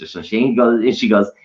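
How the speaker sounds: ordinary delivery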